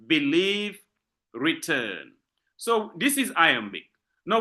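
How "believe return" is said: believe and return, each leaning on its last syllable; measured model of be-LIEVE, re-TURN; stress on second syllables. Shown as a demonstration. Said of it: "I repeat".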